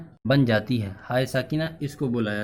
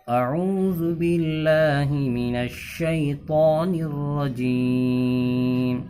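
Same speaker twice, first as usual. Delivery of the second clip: Quranic recitation; 2 semitones higher, much slower than usual. Shown as a demonstration. Teacher recites the example for students to follow. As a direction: recite slowly with measured elongation. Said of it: "جیسے".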